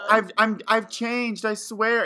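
high, hammed-up begging ex; mock plea. Jet begging Katara, high hammed-up plea. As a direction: swear it like a fake-sincere ex.

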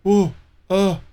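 mock the caller tripping over words; hammed-up stammering scammer. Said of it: "stuttering and stammering".

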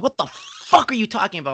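mock baffled fury at the cashier; retold outrage. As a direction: play it up as indignant. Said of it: "I'm like".

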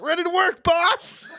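high, loud eager worker; chipper. Retold or imitated. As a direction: chirp it like a keen employee. Cheerful with Sharpie face.

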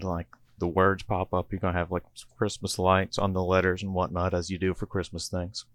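usual speaking voice

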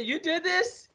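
high, stunned question; high disbelief. Retold or imitated. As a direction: squeak in disbelief. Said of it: "He said".

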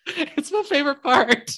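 high, wheezing with laughter; barely holding it together; laughing. Real, not acted. Laughing to her friend about her favourite line.

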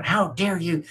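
high indignant mock squawk; mock outrage. Streamer mimics an outraged constituent's letter, high and mocking. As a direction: ham up a shocked letter-writer. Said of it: "Don't start going".

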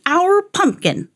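slow, high, mild holler; hollering old man. A narrator's reading. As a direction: call it out high and drawn out. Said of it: "hollered the old man".

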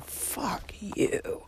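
whispered curse, drawn-out and seething; hushed, venomous aside; retold quiet fury. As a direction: curse the video maker under your breath. Retold anger at the videos.

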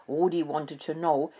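ordinary delivery, nothing marked

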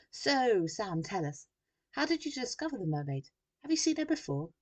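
high, loud, pushy questions; eager reporter. A narrator's reading. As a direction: press him for the scoop.

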